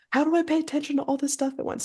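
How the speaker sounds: high, fast panicked blurt; freaking out. Acted. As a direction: rattle it off in a high, frantic voice. Imitates over-caffeinated self freaking out.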